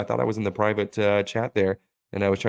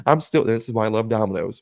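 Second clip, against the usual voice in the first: usual speaking voice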